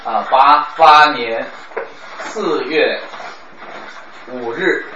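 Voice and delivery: 一九八八年四月五日 spelled out very slowly, each part apart; slow dictation. Shown as a demonstration. Teacher reading a date slowly for students to mark. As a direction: lay out the date piece by piece. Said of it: "这完全不是重读的啊".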